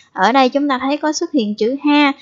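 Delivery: ordinary talk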